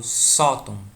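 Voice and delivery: SÓ in "sótão" hit loud; "sótão" with a strong first syllable; stress on first syllable. Shown as a demonstration. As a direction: punch the SÓ of "sótão", let "tão" drop. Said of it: "mais forte aqui".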